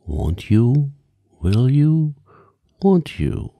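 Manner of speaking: a playful refrain rising in pitch. A narrator's reading. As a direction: build the chant up step by step. Song refrain building higher each time.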